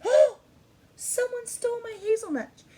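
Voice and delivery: high, loud gasping squirrel voice; a squirrel discovering its nuts are gone; panicked squirrel. Acted. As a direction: gasp and wail as the robbed squirrel. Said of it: "That's what he's looking like".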